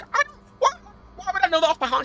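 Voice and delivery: high, incredulous comeback; exasperated disbelief. Retold or imitated. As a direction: replay your baffled outrage at a daft question. Retelling a frustrating bank call.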